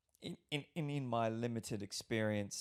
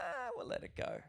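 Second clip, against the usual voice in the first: dismissive. Retold or imitated. Imitating lenient critics.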